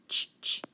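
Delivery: "ch" in whispered bursts; isolated voiceless "ch", no vowel; CH sound. Shown as a demonstration. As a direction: sound out "ch" twice, separately. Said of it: "so what do you hear?".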